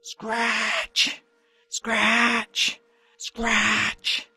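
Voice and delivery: voiced scratching sound effect; scratching noise. A narrator's reading. Narrator voicing the cupboard noise, raspy scratch. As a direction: rasp out a scratch like claws.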